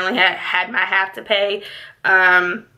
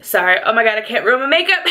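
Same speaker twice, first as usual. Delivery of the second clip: ordinary talk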